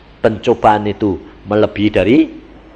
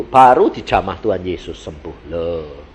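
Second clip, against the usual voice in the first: usual speaking voice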